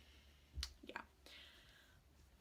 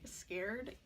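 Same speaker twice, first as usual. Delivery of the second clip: usual speaking voice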